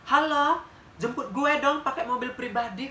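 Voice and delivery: imitating the beggar's loud pretend call for a private car; showy fake phone call. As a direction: put on a fake rich caller act.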